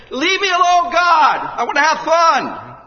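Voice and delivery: high, loud cry of defiance at God; girl rebelling against God; defiant shout. Retold or imitated.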